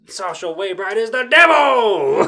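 loud accusing shout; hammed-up yelling mom; mock outburst. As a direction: denounce her as the devil, loud.

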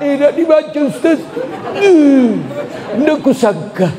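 high-pitched gossiping wife, slow surprised end; wife's voice. Retold or imitated. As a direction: play the wife confiding to the ustaz. Wife voiced, high far timbre.

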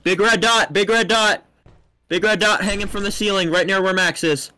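loud, insistent alert; urgent callout. Real, not acted. Spectator spots danger near Max, loud urgent callout. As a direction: call out the danger over everyone.

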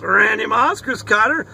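normal talking voice

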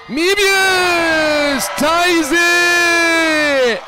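long, drawn-out, high shout; ecstatic scoring call. Real, not acted. Commentator as Mevius ties the game, ecstatic drawn-out shout. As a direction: stretch every word into a soaring cry. Calls the game-tying basket.

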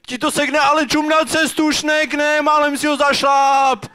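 high-pitched scolding, drawn out; scolding dad. Acted. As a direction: holler a warning at your son on the trail. Father scolding son on the hike.

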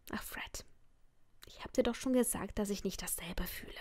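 soft, embarrassed whisper. Acted. Narrator turns Fred down on the phone, embarrassed whisper. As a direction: let him down in a hushed whisper.

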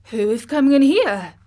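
quick startled question. Acted. Colin startled by someone entering the garden, quick. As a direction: ask it fast, alarmed. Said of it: "he said quickly".

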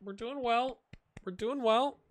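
high-pitched cheerleading voice; little encouragement. Pretend. Streamer encouraging the team, high-pitched little cheer. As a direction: squeak out some encouragement in a high voice. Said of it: "I'm just gonna do a little encouragement".

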